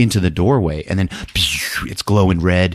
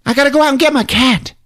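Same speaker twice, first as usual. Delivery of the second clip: very high, louder, fast fretting; squeaky fretting; 3 dB louder than usual. Retold or imitated. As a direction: rush it out in a squeaky worry. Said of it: "Geraldine's like".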